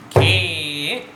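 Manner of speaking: GHE held long and firmly; drawn-out syllable call. Shown as a demonstration. Teacher demonstrates the stroke, syllable drawn out. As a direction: call out a sustained tabla syllable. Calls syllable for pupils to repeat.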